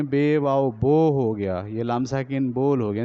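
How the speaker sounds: slow, drawn-out letter-by-letter spelling. Shown as a demonstration. Teacher showing how not to read, spelling slowly. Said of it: "ऐसा नहीं करेंगे कि वाव आएगा तो ये बोलेंगे".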